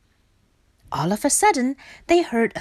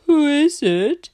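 higher, louder woken-up question; sleepy voice; 7 semitones higher, 6 dB louder than usual. A narrator's reading. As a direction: call out as someone just roused. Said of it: "asked a sleepy voice".

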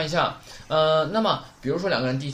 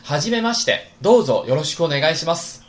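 loud, higher teacher model reading; model Japanese phrase; 3 semitones higher, 6 dB louder than usual. Shown as a demonstration. Teacher modelling a greeting for the class, loud and clear. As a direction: read it out crisply for students to copy. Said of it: "再来一遍啊".

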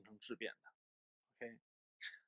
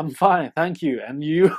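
loud, over-rehearsed stock answer; rote textbook reply; 2 semitones higher, 10 dB louder, slower than usual. Shown as a demonstration. Teacher demonstrating the worn-out greeting routine, loud rote reply. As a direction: recite the old textbook reply, punching it out. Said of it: "这个是老套路啊".

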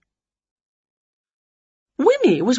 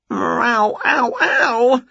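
pained cat; 6 semitones higher, much slower than usual. Acted. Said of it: "said Wilbur".

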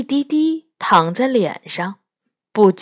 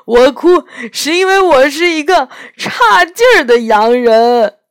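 crying out the words, higher and louder; whimpering; 7 semitones higher, 7 dB louder than usual. A narrator's reading. Faun confessing to Lucy through sobs, crying. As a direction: sob the words out. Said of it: "托木纳斯呜咽道".

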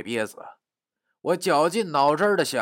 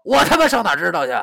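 fast, loud, high retort; indignant rapid-fire yell; struggling, cursing; 7 semitones higher, 8 dB louder, faster than usual. Acted. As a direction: swear back in exasperation while struggling. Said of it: "挣扎地骂道".

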